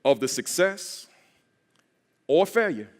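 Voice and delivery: phrase by phrase, with pauses; deliberate lead-in for a crowd to repeat; letting it marinate. Shown as a demonstration. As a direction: let each phrase sink in. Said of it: "'cause I wanted to marinate".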